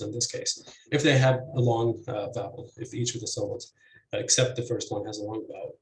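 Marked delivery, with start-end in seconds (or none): none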